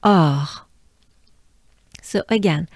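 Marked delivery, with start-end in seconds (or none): 0.0-0.5 "or" ending on a French R made as a breath. Shown as a demonstration. Teacher demonstrates "or" for learners, breathed French R. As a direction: let the R of "or" come out as a breath. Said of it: "French R as a breath".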